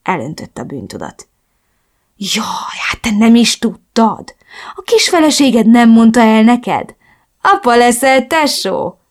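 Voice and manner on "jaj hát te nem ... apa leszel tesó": drawn-out mock sympathy, swelling louder; teasing. A narrator's reading. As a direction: taunt him, relishing the reveal. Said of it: "incselkedett tovább". (gúnyosan incselkedve)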